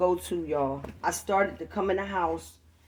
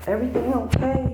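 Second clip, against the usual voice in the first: higher, louder, sing-song fake-innocent check-in; mock-innocent voice, pitched up high; innocent nosy neighbor; 9 semitones higher, 5 dB louder than usual. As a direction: play the sweet concerned neighbor who is really just nosy. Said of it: "I'm gonna be like".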